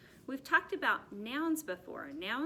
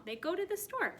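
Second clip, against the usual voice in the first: much higher sing-song reading; bright emphatic repeat; 9 semitones higher than usual. Shown as a demonstration. Teacher repeating the present tense sentence, high and bright. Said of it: "it's happening right now".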